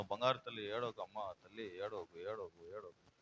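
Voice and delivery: soft, low soothing murmur; tender consoling. Acted. Preacher as God comforting a crying woman, tender consoling.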